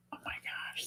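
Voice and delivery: hushed oh my gosh; whispered amazement. Real, not acted. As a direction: breathe it out in awe. Reacting to chat gift.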